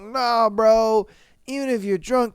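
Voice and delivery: usual speaking voice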